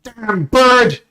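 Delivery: high, loud yelling guard; startled guard roaring orders; angry guard shout. Acted. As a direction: bellow at the men to attack. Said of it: "He goes".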